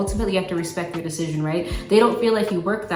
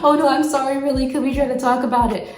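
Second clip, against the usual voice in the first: mock pleading apology; 5 semitones higher, 5 dB louder than usual. Acted apology after calming down.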